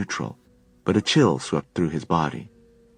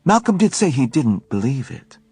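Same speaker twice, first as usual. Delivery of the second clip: Helen, admitting; 8 semitones higher, 5 dB louder than usual. A narrator's reading. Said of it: "Helen admitted".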